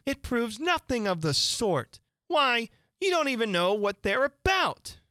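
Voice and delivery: put-on high girl's voice, protesting; Alice, indignant. A narrator's reading. Alice disputes the proof of guilt, high and indignant.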